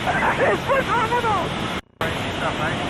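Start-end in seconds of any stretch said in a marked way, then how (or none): 0.0-1.5 slow, high, breathless wonder; drawn out in sheer amazement; awestruck. Real, not acted. Awed by world upside down.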